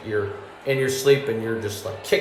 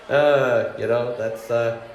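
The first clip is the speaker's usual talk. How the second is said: usual speaking voice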